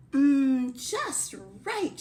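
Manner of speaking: high, pleased hum into a little cry; delighted cry. Acted. Goldilocks trying the little chair, delighted cry. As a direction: relish it, pitched right up. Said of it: "she cried".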